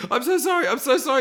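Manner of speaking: a high panicked apology after the kick; frantic apology. Retold or imitated. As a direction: squeal the apology in a high rush.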